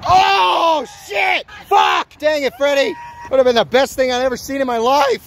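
high, loud outburst of dismay; excited shout. Real, not acted. Watcher reacting to Freddy's missed trick, excited shout. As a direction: yell it at the top of your range.

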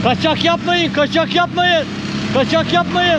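loud high calls across a field; calling out loud. Real, not acted. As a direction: shout to round everyone up. Herding a crowd, calling out. (yüksek sesle seslenme)

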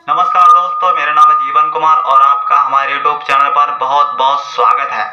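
loud announcement through a megaphone; megaphone greeting. Shown as a demonstration. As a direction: greet the crowd over the loudspeaker. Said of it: "मैं आपको करके यहाँ पे दिखा रहा हूँ". (मेगाफोन पर ज़ोरदार स्वागत घोषणा)

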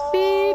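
loud, high mock beep; beep sound. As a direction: beep like a phone. Imitating hang-up tone.

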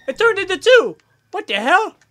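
high, loud disbelief; shocked outburst. Real, not acted. Player watching a creature split, blurting out shock. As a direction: blurt it out as the thing doubles.

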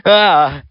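high, loud sharp cry of pain. Acted. Suddenly in pain, a high loud sharp cry. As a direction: yelp as if suddenly hurt. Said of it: "cried J F J in pain".

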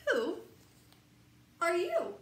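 high, loud inviting question; excited question. Acted. Reader asking the child the book's final question, high and loud. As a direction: throw the question out to the child with delight.

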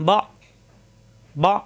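"বা" cut off in the throat; "বা" stopped short; hard hamza stop. Shown as a demonstration. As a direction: say each "বা" with a firm glottal catch. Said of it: "শক্ত করে পড়তে হবে".

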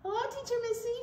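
high, sweet puppet voice for a friendly zebra. Pretend. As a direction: greet her as a cheerful puppet zebra. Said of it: "Zebra would like to be our story time friend".

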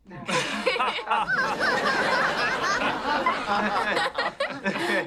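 long high-pitched laugh. Acted. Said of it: "I know why I'm laughing".

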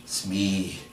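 soft, husky address. A narrator's reading. Hook turns to Smee in fear, huskily. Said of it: "he said huskily".

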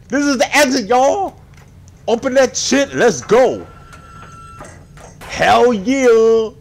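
high, loud victory yell; thrilled, full-voiced hollering; elated shouting. Real, not acted. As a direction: shout as if escape is finally here. Finds exit, celebrates.

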